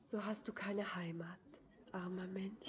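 soft, voiceless, tender whisper; pitying whisper. Acted. Girl pitying the homeless stranger, in a soft whisper. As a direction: hush it out with pity.

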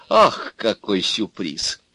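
usual speaking voice

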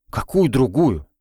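baffled question; astonished. A narrator's reading. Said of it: "крайняя степень изумления".

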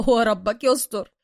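loud, slow chuckled remark; laughing. A narrator's reading. Bruce finishing his sentence to Suka, laughing. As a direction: let a laugh run into the words. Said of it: "ثم أكمل وهو يضحك".

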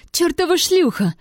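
high-pitched angry insult; shrieky squeal. A narrator's reading. Jealous Tiana attacks the narrator from behind, squealing. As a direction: squeal it out like an irritating pest. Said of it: "её писк над ухом".

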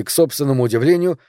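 ordinary delivery

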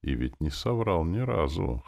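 usual speaking voice

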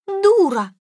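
high, loud cry; shrieked insult. A narrator's reading. Weeping woman insults the searcher, high shriek. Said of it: "вскричала Екатерина Петровна и снова зарыдала".

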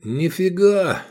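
high, drawn-out cheerful admission; joyful. A narrator's reading. Pupil cheerfully admits he understood nothing, joyfully. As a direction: declare it gleefully, stretching it out. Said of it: "радостно заявил Эдик".

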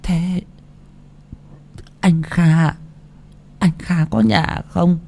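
put-on soft, high, slow old woman voice; soft frail old voice; frail old mother. A narrator's reading. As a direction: ask feebly like a tired half-blind old woman. Old blind mother asks feebly.

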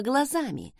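ordinary delivery

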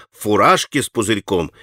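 ordinary delivery

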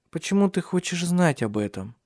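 high, guarded question; evasive. A narrator's reading. Ursula avoiding talk of Alexander's youth, evasive. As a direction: deflect with a question, a touch wary. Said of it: "уклончиво спросила Урсула".

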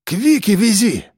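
high-pitched, urgent plea; insistent, worried request; pleading. A narrator's reading. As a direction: beg anxiously to change course. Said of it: "стал тогда просить Андрей".

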